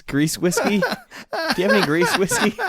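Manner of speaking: high, giggling through the words; laughing mock order. Retelling the bar mix-up with a high, laughing mock order. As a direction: laugh while playing your confused younger self.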